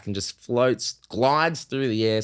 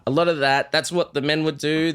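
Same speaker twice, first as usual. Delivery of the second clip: ordinary talk, nothing marked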